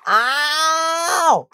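high, sharp yelp of pain; cry of pain. Acted. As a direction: yelp as if struck. Stephen gets hit.

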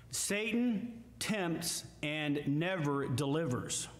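ordinary talk

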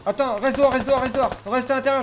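high, loud urgent orders; shouting. Real, not acted. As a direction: yell it to stop him. Yelling orders at teammate.

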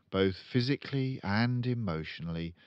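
ordinary delivery, nothing marked

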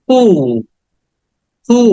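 কু with a stretched "oo", no final p; long vowel. Shown as a demonstration. Teacher shows the silent p, long drawn vowel. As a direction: hold the vowel of কু, "kooo". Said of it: "long sound এটা".

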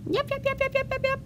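very high, squeaky puppy yaps; loud puppy yapping. Acted. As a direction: yap at the museum door like a tiny dog. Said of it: "began to yap loudly".

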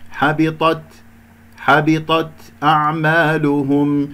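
"حبطت أعمالهم" recited slowly with a light, brief breath on the ت; correct ت. Shown as a demonstration. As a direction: chant "حبطت" with an unbounced ت, then drawn-out "أعمالهم". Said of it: "هكذا".

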